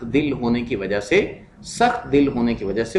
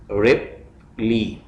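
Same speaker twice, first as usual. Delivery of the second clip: Ripley as रिप, pause, ली; split into syllables; 3 semitones lower, much slower than usual. Shown as a demonstration. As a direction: break Ripley into two separate beats. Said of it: "syllables में divide करना है".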